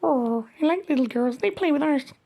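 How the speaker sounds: ordinary talk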